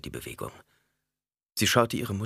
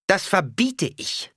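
high, slow, loud command; forbidding; 13 semitones higher, 5 dB louder, slower than usual. A narrator's reading. Alia forbids the death sentence, loud and commanding. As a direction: slam the order down.

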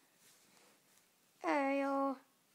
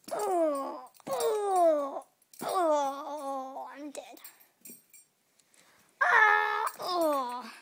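dying groans; 8 dB louder, much slower than usual. Pretend. Pet dying.

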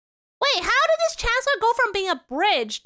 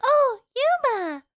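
put-on anime girl call; 7 semitones higher, 3 dB louder, much slower than usual. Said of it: "they're like".

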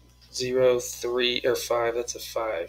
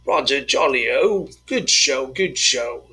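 hammed-up British toff accent; goofy British accent cheering the answer; mock British; 5 dB louder, slower than usual. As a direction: play a jolly British chap, loud and slow. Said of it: "I always did British a lot".

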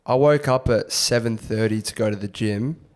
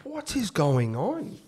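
mock disbelief; 8 semitones higher, 6 dB quieter, slower than usual.

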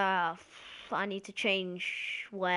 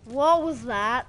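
loud, drawn-out alarm; startled cry; 3 semitones higher, 12 dB louder, much slower than usual. Real, not acted. Gamer startled by something unseen, loud drawn-out cry. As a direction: stretch it out loudly in disbelief.

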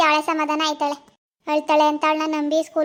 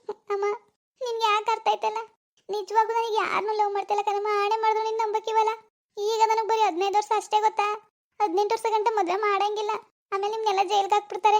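put-on higher, softer pleading girl voice; teenage girl pleading; 6 semitones higher, 4 dB quieter than usual. Girl pleads with her mother against marriage, higher and softer. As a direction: beg mother not to marry her off.

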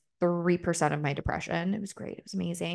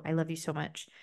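ordinary delivery

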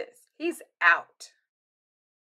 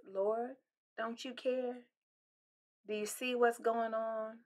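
soft, small lament to God; hushed plea; 12 dB quieter than usual. Retold or imitated. Pastor voicing the heart's quiet cry, hushed. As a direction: murmur the doubt under your breath.

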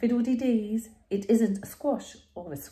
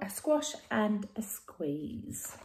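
soft slow whisper; 5 dB quieter, slower than usual. Shown as a demonstration. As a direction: breathe the title slowly. Whispered book title.

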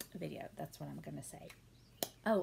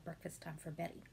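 ordinary talk, nothing marked

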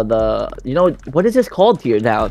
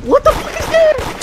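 slow high astonished question; shocked; slower than usual. Real, not acted. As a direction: stretch it out in disbelief. Spots strange thing in sky.

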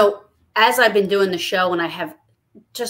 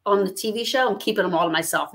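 ordinary delivery

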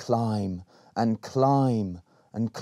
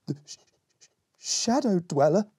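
scared, stammering higher voice; stuttering; 8 semitones higher than usual. A narrator's reading. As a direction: trip over the sh in fear. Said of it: "stuttered Berry".

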